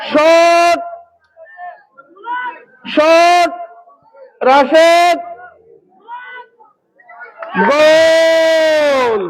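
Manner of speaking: building shout to a long held goal call; frantic football commentary. Real, not acted. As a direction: call the shot then scream goal. Commentator calls shot and goal.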